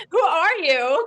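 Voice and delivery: high, loud amazed question; mock wonder. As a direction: marvel out loud at a new friend. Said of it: "It's like".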